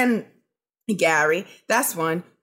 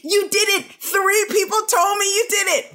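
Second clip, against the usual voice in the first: jab it like a wronged mate; mock accusing shout; 8 semitones higher than usual. Host playing Gary accusing Scotty, a mock shout.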